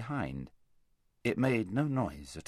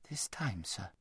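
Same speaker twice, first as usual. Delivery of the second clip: very soft, hesitant question; timid girl; 11 dB quieter than usual. A narrator's reading. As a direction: ask him meekly and quietly. Girl timidly questions great lion.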